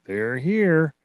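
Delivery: light imitation of a ghostly voice; eerie other voice. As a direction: mimic a strange voice on the recording. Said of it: "you hear another voice go".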